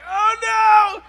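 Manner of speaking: loud, high "oh no"; dismayed cry. Retold or imitated. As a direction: shout it in sudden alarm. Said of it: "I was like".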